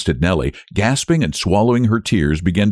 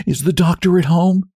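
put-on higher, anxious young woman; urgent woman at the gate; 10 semitones higher than usual. A narrator's reading. Nellie voiced pleading at gate.

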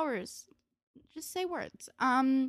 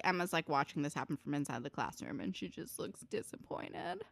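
ordinary talk, nothing marked

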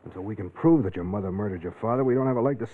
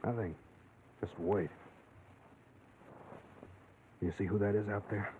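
soft, low and hushed, hiding in the dark; 4 semitones lower, 12 dB quieter than usual. Acted. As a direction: keep it low so the cop can't hear. Hiding in barn from police.

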